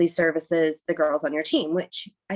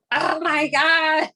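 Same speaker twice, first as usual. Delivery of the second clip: higher, slow, drawn-out dismay; deflated disbelief; retold exasperated relief; exasperated oh my gosh; 9 semitones higher, 5 dB louder, much slower than usual. Realising she rushed for nothing.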